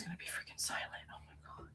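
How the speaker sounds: fast, breathy whisper. Real, not acted. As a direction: whisper so the stream can't hear. Whispering while watching muted trial.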